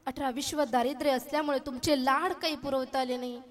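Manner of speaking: normal talking voice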